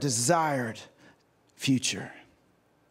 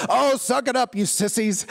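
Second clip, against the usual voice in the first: mock scolding, starting higher then dropping; big man scolding; 4 semitones higher, 3 dB louder than usual. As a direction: imitate a big man's brusque rebuke. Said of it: "this really big, large man".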